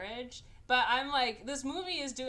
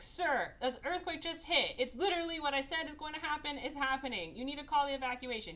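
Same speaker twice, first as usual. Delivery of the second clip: high, insistent, acted urgency; urgent plea; 6 semitones higher than usual. Scientist begs official to call the evacuation, urgent plea. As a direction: press the boss urgently, pitched up.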